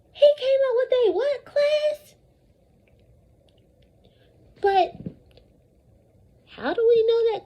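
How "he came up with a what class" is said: high eager prompt. Real, not acted. Prompting class to fill in.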